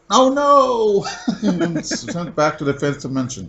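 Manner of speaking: high laughing villain wail; laughing mock defeat. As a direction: cry out defeat through laughter.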